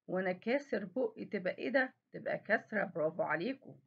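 ordinary talk, nothing marked

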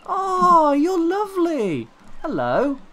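high, slow cooing; adoring coo. Real, not acted. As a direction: melt over a cute pet. Adoring the animal.

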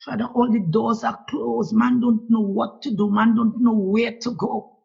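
normal talking voice

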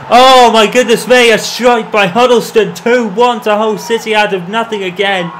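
sudden loud, high goal call; goal excitement. Real, not acted. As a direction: erupt as the shot flies in. Sudden goal, commentator erupts.

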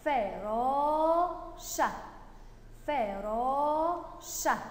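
"فراشة" very slow, long "aa" held; spelling aloud while writing. Shown as a demonstration. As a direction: sound out "فراشة" as each part is written. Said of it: "واشتهجها كده وأنا بكتبها". (تهجئة بطيئة بمدّ الألف)

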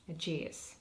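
plain reading voice

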